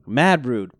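normal talking voice

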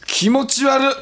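high grossed-out ew; disgusted ew. Real, not acted. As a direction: recoil with a shudder. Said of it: "思い出した".

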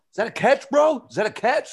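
high-pitched call-out to a celebrity; retold drunken heckling. Drunk fan calling to Kirk Herbstreit, high heckling. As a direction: pipe up high, pestering for an answer.

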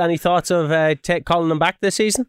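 low, quick post-match reporter; TV interviewer voice. Acted.

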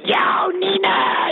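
mock call, breathy and very high; half-whispered imitation of firemen calling out, high and slow; imitating a shout. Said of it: "they're going".

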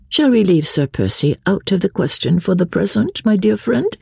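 high, polite society lady; lively lady, deflecting. Acted. Marguerite sets her husband's name aside, high and light. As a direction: steer away lightly, pitched high.

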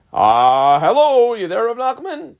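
high, loud, drawn-out hello; calling at the door. Acted. Rava knocking on Rav Nachman's door, calling out. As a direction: playfully call out to see who's home.

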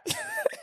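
a quick, high, breathy giggle; soft high giggle; high squeal of laughter. Real, not acted. Laughs at submission.

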